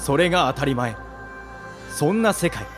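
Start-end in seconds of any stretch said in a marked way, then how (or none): none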